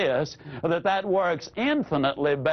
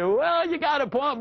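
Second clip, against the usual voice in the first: much higher voice; mock buyer conceding; 11 semitones higher than usual. Prospect conceding to the salesman, high voice. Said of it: "they might say".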